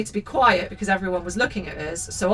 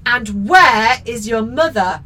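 slow, loud stern demand; angry man; 8 dB louder, slower than usual. A narrator's reading. Shop man standing over the children, angry. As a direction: confront the children slowly and loudly. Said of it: "he asked angrily".

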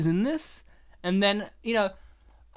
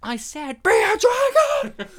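imitation of a sudden high-pitched outburst; exasperated shout; 14 semitones higher, 7 dB louder, slower than usual. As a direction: blow up loudly, stretching out the words. Acted exasperated repeat of quiet line.

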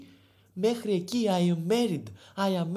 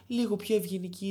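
normal talking voice

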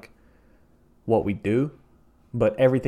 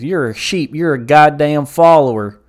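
louder, higher mock accusation; 5 semitones higher, 10 dB louder than usual. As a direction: imitate a loud heckler hurling insults. Acting out the heckling redneck.

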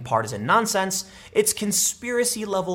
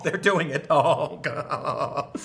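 laughing; much slower than usual. Real, not acted. Laughing at the clip.

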